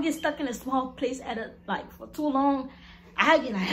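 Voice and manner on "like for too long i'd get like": usual speaking voice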